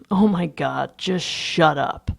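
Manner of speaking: loud, fed-up order slowing at the end; exasperated outburst. A narrator's reading. Narrator shakes Lewis, exasperated. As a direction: lean hard on the last words. Shaking Lewis to silence him.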